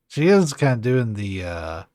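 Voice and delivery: ordinary talk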